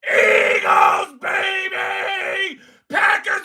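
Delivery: crank it up and hype the crowd; hype shout. Acted. Fan streaming to chat, hype shout. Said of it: "I'm about to bring it out".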